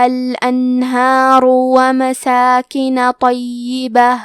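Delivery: slow, drawn-out tarteel recitation; Quran recitation. Acted. Teacher recites the verses for students, slow tarteel. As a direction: let every vowel stretch, measured and melodic.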